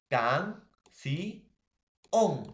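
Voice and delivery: "canción" split into separate drawn-out pieces; teacher slowly breaking "canción" apart, each piece punched; syllable by syllable. Shown as a demonstration. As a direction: sound out "can, si, on" with pauses between. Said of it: "sion es la mayor fuerza de voz".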